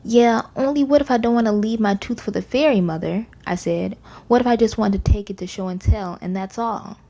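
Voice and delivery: usual speaking voice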